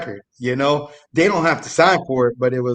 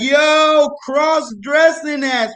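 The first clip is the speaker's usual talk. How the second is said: high, drawn-out mock holler; imitating a jeering crowd; jeering call; 12 semitones higher, much slower than usual. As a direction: crow it high, teasing a buddy. Crowd mocking the prank victim.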